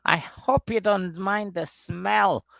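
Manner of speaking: high, very slow put-on voice; mock sheepish apology. Joking as Debbie warning Tony about home, mock apology. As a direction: draw it out high and coy.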